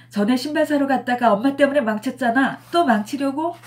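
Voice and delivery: loud, high sulky retort; snapping daughter. A narrator's reading. Daughter snaps at mother, loud and high. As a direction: daughter snapping back at her mom.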